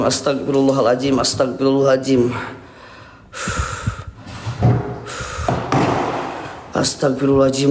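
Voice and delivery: low, measured recitation; self-calming prayer. Real, not acted. Shaken ghost hunter praying to calm himself, low recitation. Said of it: "gua menenangkan diri".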